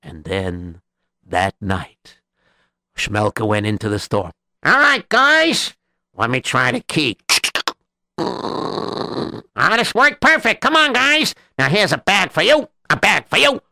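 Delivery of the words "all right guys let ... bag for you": high excited gang boss; rally the thieves, bright and eager; gleeful thief leader. Acted. Thief boss voiced in story.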